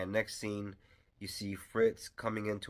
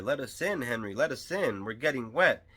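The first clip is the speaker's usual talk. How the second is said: higher, louder acted-out plea at a door; mock shouting; 5 semitones higher, 6 dB louder than usual. Professor's party shouting at Henry's door in the rain, mock shouting. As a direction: call up to be let in, imitating a shout. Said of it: "they're shouting at the door".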